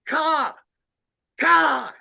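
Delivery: three sharp cawing calls; imitated crow caw. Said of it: "another one down below it".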